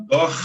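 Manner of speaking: "dor" ending in an aspirated Rio R; "dor" with the carioca guttural final R. Shown as a demonstration. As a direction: finish "dor" on a breathy H-like R, Rio style. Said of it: "com esse sotaque de carioca".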